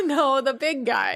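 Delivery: said through a laugh. Real, not acted. Correcting her cohost a second time while laughing. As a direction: repeat the correction, cracking up.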